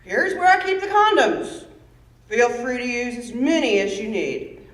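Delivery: loud, emphatic dad laying down rules; dad's loud, deliberate instructions; lightly voiced father. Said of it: "And he told me this".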